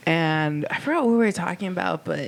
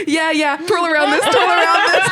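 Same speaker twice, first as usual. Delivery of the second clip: high, loud, laugh-filled voice; real laughter while imitating a friend; 13 semitones higher, 9 dB louder than usual. Real, not acted. Teller laughing, quoting Sunny.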